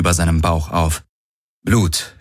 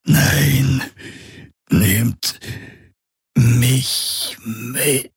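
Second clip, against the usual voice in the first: slow, eerie plea, word by word; ghostly whisper; 6 semitones higher, much slower than usual. A narrator's reading. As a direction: plead like a spirit, stretching each word. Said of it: "wisperte eine Geisterstimme". (gedehnte, flehende Geisterstimme)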